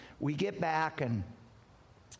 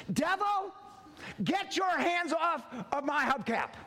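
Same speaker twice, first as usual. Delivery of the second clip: mock rebuke of the devil; 9 semitones higher than usual. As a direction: command the devil off the hubcap. Rebuking the devil at golf course.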